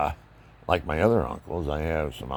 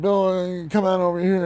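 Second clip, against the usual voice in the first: high, loud call across the house; 6 dB louder than usual. Retold or imitated. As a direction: call him over, raised voice. Uncle calling him over.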